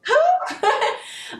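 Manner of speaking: very high, shocked squeak with a laugh; shocked who. Retold or imitated. Mother hearing the child snitch: shocked high squeak. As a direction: jolt up in disbelief, laughing. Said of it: "You'll be like".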